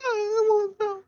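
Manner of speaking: ghostly voice. Acted. Voice changer ghost cousin.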